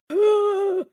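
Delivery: sharp, high, hammed-up gasp; loud mock gasp. Player hurt that a villager forgot them, mock gasp. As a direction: gasp as if wounded. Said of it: "I was like".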